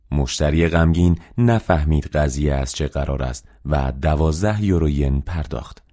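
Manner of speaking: ordinary delivery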